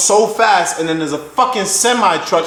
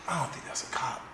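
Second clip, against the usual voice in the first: whispered thought to yourself; very soft, voiceless aside; hushed doubt; 4 semitones lower, 19 dB quieter than usual. Retold or imitated. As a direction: say it under your breath. Retells doubtful thought while driving.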